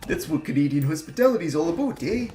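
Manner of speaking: mock the American TV Canadian accent; exaggerated TV Canadian accent. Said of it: "Canadian accents from American TV".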